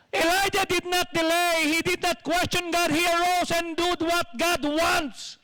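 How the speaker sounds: loud, high, forceful sermon delivery; preaching at full force. Acted. Preacher urges the church to obey at once, loud and high. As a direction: drive the point home to the congregation.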